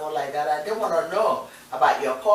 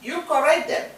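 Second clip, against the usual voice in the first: normal talking voice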